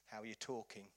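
usual speaking voice

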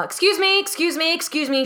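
high, pushy excuse me getting through a crowd; mock pushy passenger calling out; impatient woman. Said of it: "I hear this woman go".

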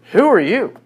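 high, loud scornful question; a prophet mocking the Assyrian army, high and scornful; scornful challenge. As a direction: look down on the boaster. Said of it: "I love his speech".

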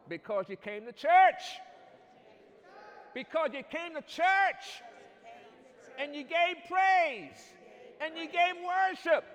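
high shouted preaching whoop, slow. Acted. Whooping climax of sermon.

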